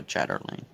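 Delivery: chatterlane with an r after "chat"; mispronounced. Real, not acted. Stitcher explaining a harder craft, mispronounced chatterlane as "chatter-lane". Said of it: "After I said it wrong three times".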